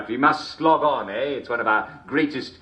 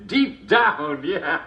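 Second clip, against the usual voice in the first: laughing echo; 7 semitones higher, 3 dB louder, much slower than usual. Real, not acted.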